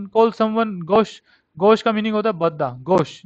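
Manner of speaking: ordinary delivery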